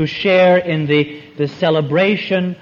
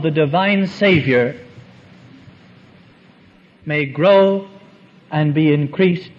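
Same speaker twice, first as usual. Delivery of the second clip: quoted prayer; 3 semitones higher than usual. Retold or imitated. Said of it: "his prayer went something very close to this".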